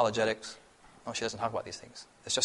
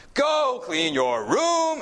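much higher, louder, slow command; loud serious order; 12 semitones higher, 4 dB louder, much slower than usual. Shown as a demonstration. Parent demanding a child clean up, loud and stern. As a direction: bark the order, leaning on room. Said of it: "it's a little bit more serious".

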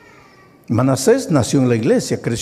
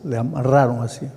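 ordinary talk, nothing marked

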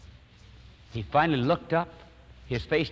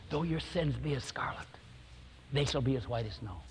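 soft, near-whispered verse; hushed, tender scripture reading; hushed scripture quote; 9 dB quieter than usual. Retold or imitated. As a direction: murmur the promise gently to a weeping man. Quoting scripture softly to sobbing man.

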